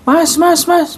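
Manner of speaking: high, pleading calls of mas; calling out to a stranger. Shown as a demonstration. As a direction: call out high to passers-by for help. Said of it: "Nakirah mak".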